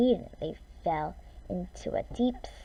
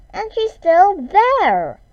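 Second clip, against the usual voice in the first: put-on high, loud, slow baby bear cry; loudest little voice; 12 semitones higher, 12 dB louder, slower than usual. A narrator's reading. Baby Bear finds Goldilocks, high slow loud cry. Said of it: "in his loudest little voice".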